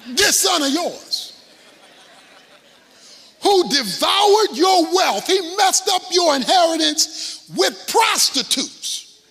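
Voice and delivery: high, loud, slow accusation; indignant shout. Acted. Jealous older son shouting at the father about his brother, indignant. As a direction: bellow it slowly at the father.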